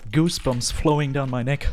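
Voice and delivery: ordinary delivery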